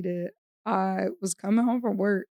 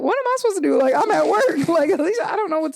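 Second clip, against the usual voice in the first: higher, exasperated mother on the phone; exasperated mom; 7 semitones higher, 4 dB louder, faster than usual. Retold or imitated. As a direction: throw up your hands as an annoyed mom.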